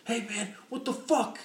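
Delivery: high, mock-angry yell; shouted road rage, hammed up; angry driver quote. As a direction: play the furious guy in sandals. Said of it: "he's just like".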